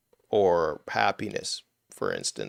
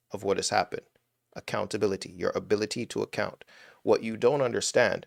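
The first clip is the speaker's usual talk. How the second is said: ordinary delivery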